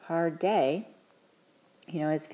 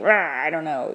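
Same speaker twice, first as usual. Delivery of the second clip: a mock frustrated teen growl, louder; imitating an overwhelmed teen pushing away; flooded teen; 8 dB louder than usual. Mother imitating her flooded teenager, a half-hammed frustrated grunt. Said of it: "they might be like".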